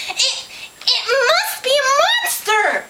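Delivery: very high, stammering pig voice; squealed storybook fright; panicked pig. A narrator's reading. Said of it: "said Pig".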